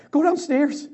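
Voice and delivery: high, loud urgent blurt; wife bursting in, blurting urgently. Retold or imitated. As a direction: burst out the words in excitement. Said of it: "she blurted".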